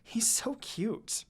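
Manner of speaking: plain reading voice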